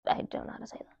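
softly whispered aside to listeners. Real, not acted. Reader admits she can't say the sound, whispered. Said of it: "reader's whispered aside".

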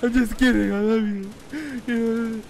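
high, loud, drawn-out cooing; teasing apology. Pretend. Taking back a tease of a friend, high gushy voice. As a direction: sing it out high and stretched.

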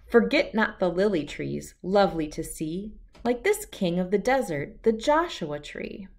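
ordinary delivery

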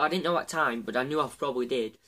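usual speaking voice

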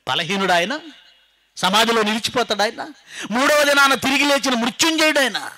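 building high and loud; preaching climax. Real, not acted. Preacher declaring the conqueror of death, building to full force. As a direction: rise to a ringing proclamation.

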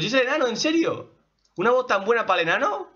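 high, quick questioning; incredulous surprise. Real, not acted. Fan questioning the dwarf's casting, incredulous. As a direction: ask in rapid, rising disbelief.

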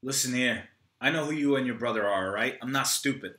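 ordinary talk, nothing marked

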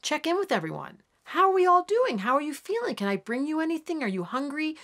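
rising, caring voice for the Cancer moon; a lightly voiced nurturing Cancer moon; Cancer moon checking in. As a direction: fuss over everyone like a mother hen. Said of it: "the moon in Cancer goes".

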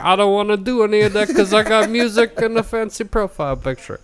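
whiny high voice. Retold or imitated. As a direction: whine like a stubborn teen. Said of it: "And I was like".

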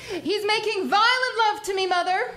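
loud, playful call up the stairs; teasing loud call. Acted. Teasing mother about George, called out loud. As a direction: tease him while calling out to mother.

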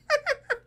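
high staccato puppet giggle; high, repeated ha-ha puppet laugh; Elmo laugh. Shown as a demonstration. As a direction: demonstrate a cartoon monster's giggle in short bursts. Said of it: "The Elmo laugh is not up here".

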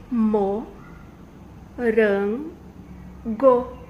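"Mo, ran, go" called out loudly as three separate beats; teacher splitting "morango"; loud syllable count. Shown as a demonstration. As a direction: belt out each syllable of "morango". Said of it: "tem quantas sílabas?".

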